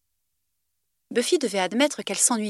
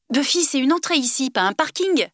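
raised, louder protest; Alex protests loudly, higher pitch; 6 semitones higher, 5 dB louder than usual. A narrator's reading. Alex scolds Buffy blocking the door, louder protest. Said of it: "protesta-t-il".

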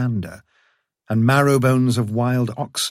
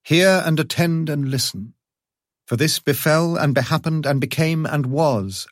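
grand, high opening incantation; storyteller invocation; 5 semitones higher than usual. A narrator's reading. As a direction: call the listener in like an old tale.

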